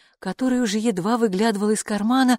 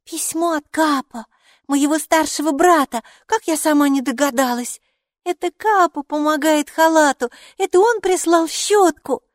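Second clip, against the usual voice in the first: put-on high, loud little-girl voice; excited child; 10 semitones higher, 6 dB louder than usual. A narrator's reading. Майки recognises her brother's letter, high excited child voice. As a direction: announce it in a high, delighted child's voice.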